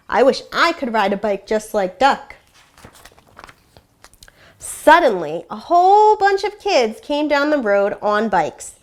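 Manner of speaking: lively, expressive storybook reading with animal voices; story read aloud to children. A narrator's reading. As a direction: read it like a classroom read-aloud, bringing each animal to life. Teacher reads picture book aloud.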